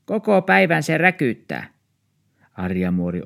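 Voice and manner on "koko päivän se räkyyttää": put-on high, louder old woman's complaint; complaining old woman. Old neighbour complains at the door, high and grumbling. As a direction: moan about the barking.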